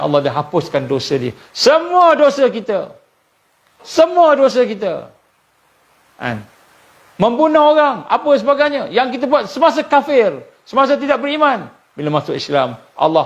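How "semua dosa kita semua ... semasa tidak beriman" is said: high, intense sermon cry; fervent preaching. Real, not acted. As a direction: proclaim forgiveness with rising passion. (ceramah berapi-api, nada tinggi)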